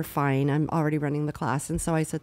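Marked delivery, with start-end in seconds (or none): none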